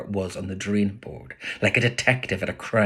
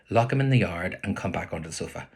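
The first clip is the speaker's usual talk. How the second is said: ordinary delivery, nothing marked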